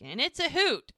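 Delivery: high, loud imitation of a cheery pitch; mock enthusiasm. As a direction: sell it brightly in his voice, hammed-up. Doc's retold upbeat sales pitch.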